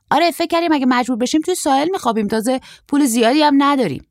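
put-on high, loud, quick young man's voice; fast, high-pitched boyish chatter; short surfer, carefree. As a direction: play an eager young traveller, pitched well up. Short surfer tells their plan.

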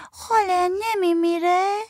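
put-on high child voice, anxious question; worried little girl. A narrator's reading. Little girl asks her aunt if he will die, high child voice.

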